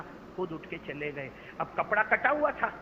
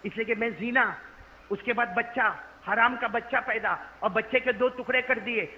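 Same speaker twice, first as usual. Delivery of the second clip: ordinary talk, nothing marked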